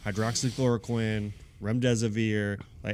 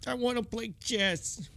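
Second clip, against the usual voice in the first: high, slow whiny mockery of a pampered celebrity; 11 semitones higher, 4 dB quieter than usual. Cohost mocking a locked-down celebrity, high slow whine. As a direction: whine it like a spoiled rich star.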